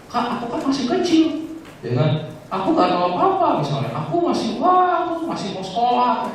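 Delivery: put-on high, small child voice begging; high childlike whine; childish plea. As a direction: plead like a little kid who wants to stay in school. Child Ian pleading with God.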